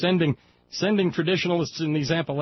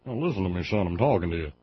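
put-on condescending Southern accent; 7 semitones lower, faster than usual. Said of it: "with that accent for a reason".

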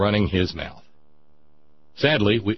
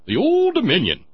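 mock grandeur; 10 semitones higher, 4 dB louder than usual. Mock grand regional name.

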